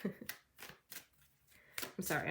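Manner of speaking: slow, breathy laugh-through sorry; laughing apology. Real, not acted. Reader apologising to viewers, giggling at the sarcasm. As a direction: apologise while still giggling. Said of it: "I'm laughing at the sarcasm".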